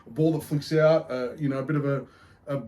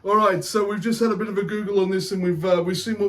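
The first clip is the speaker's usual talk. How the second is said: put-on Irish accent; 5 semitones higher than usual. Beer show host greets punters, hammed-up Irish accent. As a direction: put on a cheeky Irish brogue. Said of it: "keep this accent up".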